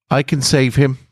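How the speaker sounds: high, small voice piping up; a tiny angel speaking up from the floor. Acted. Small Thelenos calling up to the archangel, high and tiny. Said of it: "A tiny voice".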